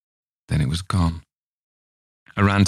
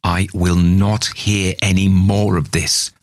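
slow, louder stern outburst; mother snaps at her daughter, slowed and raised; shouted; 3 semitones higher, 4 dB louder than usual. Acted. Mother shouts at Chris to stop, slow loud outburst. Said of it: "I shouted".

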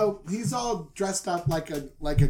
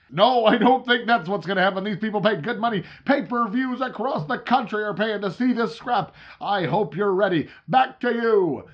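announcer sign-off; 5 semitones higher, 7 dB louder than usual. Acted. Announcer wrapping up the pre-fight spot.

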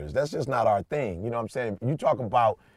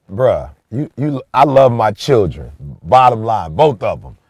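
louder, insistent declaration; emphatic; 8 dB louder than usual. Real, not acted. Dad declaring love for his kids, loud and emphatic.

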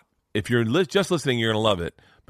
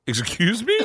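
surprised; 11 semitones higher, slower than usual. Retold or imitated. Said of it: "she was like".

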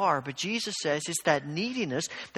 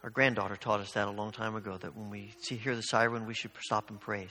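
ordinary talk, nothing marked

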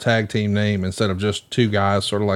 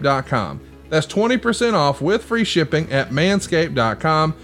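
upbeat sponsor read, a little higher; 5 semitones higher than usual. Acted. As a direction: pitch the product like a host ad. Host reads sponsor ad.

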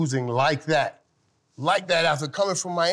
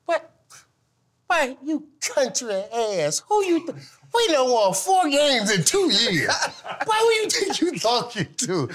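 incredulous retold outburst; 11 semitones higher than usual. Retelling how he scoffed at his rookie roommate, mock outrage. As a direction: put the cocky rookie in his place, half joking. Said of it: "I said".

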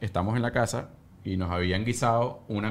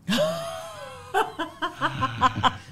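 high laughing squeal. Real, not acted. Laughs after the advice about sleep.

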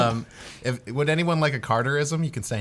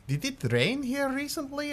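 character asking; 5 dB quieter than usual. Acted. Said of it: "I'm like".

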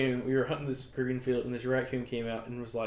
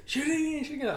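urgent uncle's order; 13 semitones higher, faster than usual. Retold or imitated. Uncle urging boy at a wounded raccoon, urgent. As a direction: imitate an uncle urging, voice pitched up.

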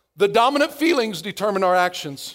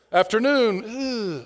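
normal talking voice

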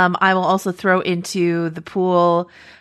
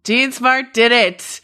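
mock dramatic verdict; 6 semitones higher, 4 dB louder, slower than usual. Echoing guess as dramatic verdict.

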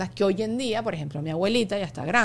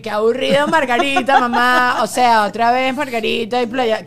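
louder mock whine of bored kids; bored kids' whine; 3 semitones higher, 8 dB louder than usual. Guest imitating bored kids on family holidays, a mild loud whine. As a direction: whine like kids sick of the beach. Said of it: "estábamos todos fastidiados en el sofá".

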